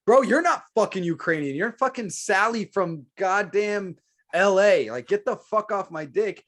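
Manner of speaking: high, loud exasperated retort; heated retort. Retold or imitated. Man answering critics in a loud angry retort. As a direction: fire it back at them, fed up. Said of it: "it's like".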